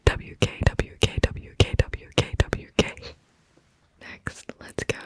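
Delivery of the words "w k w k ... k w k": rising repeated W K. Shown as a demonstration. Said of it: "written out as W K".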